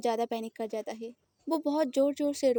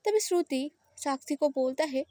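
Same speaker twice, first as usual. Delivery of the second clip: ordinary delivery, nothing marked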